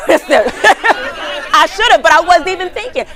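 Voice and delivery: high, loud laughing through the words. Real, not acted. Speaker laughing at her own story, loud laughter.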